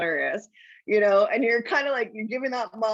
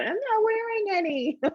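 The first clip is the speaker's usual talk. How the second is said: put-on high, squeaky little-kid voice; retelling the kid's best line, much higher; gleeful kid line; 12 semitones higher than usual. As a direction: replay the kid's punchline in a tiny high voice. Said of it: "that was just one of the fun".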